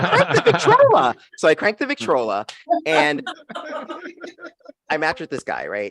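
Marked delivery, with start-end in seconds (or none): 0.0-1.1 much higher, laughing, prissy antique voice; old-timey mock voice. Comic mocking her own age to the audience, in a much higher, laughing old-timey voice. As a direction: sound like a lady from a century ago.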